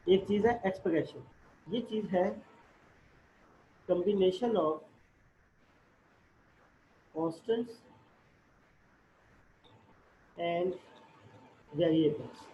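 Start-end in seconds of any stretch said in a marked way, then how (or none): 3.9-12.4 "constants", "and", "variables" spoken word by word with long pauses; slow dictation. Shown as a demonstration. Teacher dictating a definition to the class, slow and word by word. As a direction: dictate it slowly so students can write it down. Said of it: "अब definition की तरफ जा रहा हूँ".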